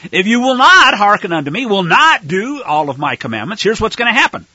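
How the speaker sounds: "not" hit hard and loud in "if you will not hearken unto me". Shown as a demonstration.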